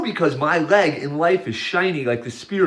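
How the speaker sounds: usual speaking voice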